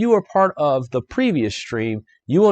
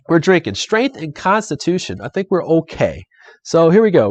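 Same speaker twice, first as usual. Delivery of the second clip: normal talking voice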